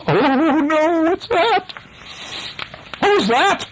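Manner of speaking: very high frightened wail; scared. A narrator's reading. Pirates hearing the screech, scared high wailing. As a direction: squeal it in hammed-up terror. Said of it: "they're so scared".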